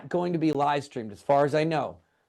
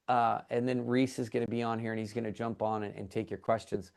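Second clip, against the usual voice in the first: normal talking voice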